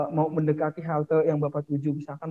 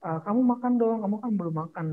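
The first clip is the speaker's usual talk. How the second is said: higher, lightly voiced coaxing boyfriend; 7 semitones higher, faster than usual. Boyfriend urging Jessica to eat, lightly voiced coaxing. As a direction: gently nag her to eat, playfully. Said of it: "ketika pacarnya, eh, bilang".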